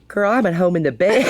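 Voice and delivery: quoting herself through a laugh; laughing reply. Retold or imitated. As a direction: laugh as you say you're home in bed. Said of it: "And I was like".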